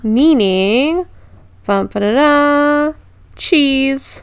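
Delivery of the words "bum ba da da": hummed drumroll build-up to the reveal; mock drumroll. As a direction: sing a little bum ba-da-da fanfare. Drumroll before big reveal.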